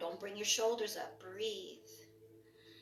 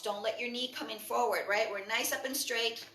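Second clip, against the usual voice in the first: ordinary delivery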